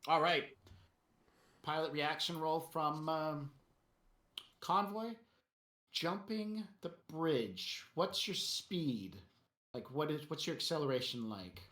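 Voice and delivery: usual speaking voice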